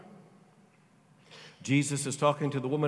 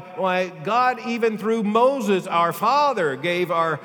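full-force preaching; 8 semitones higher, 7 dB louder than usual. Acted. Preacher to his congregation, full-force preaching. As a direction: preach it out with fire.

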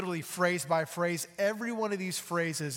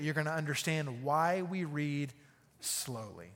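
ordinary delivery

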